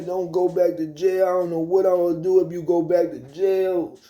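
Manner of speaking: high, drawn-out mock grandma wail; pleading grandmother. Grandmother begs grandson not to go back to jail, high pleading voice. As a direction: imitate a pleading grandma, pitched high and slow.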